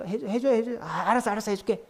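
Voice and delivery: put-on high begging child, then hurried parent relenting; pleading, then giving in. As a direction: beg insistently, then cave in.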